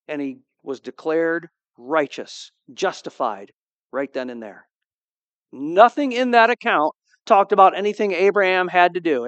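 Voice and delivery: usual speaking voice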